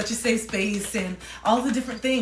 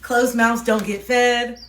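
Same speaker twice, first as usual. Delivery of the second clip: slow, louder British accent, leaning on fed; a firmer British-accented retake of the proverb; 3 semitones higher, 8 dB louder, slower than usual. Real, not acted. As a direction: repeat the saying firmly in a British voice. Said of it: "why I was having the accent".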